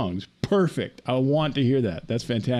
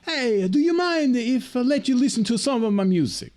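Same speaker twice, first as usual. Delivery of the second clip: much higher, friendly put-on Italian accent; Italian cabbie accent; 12 semitones higher than usual. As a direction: offer your music like the corny Italian driver. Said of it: "so he goes".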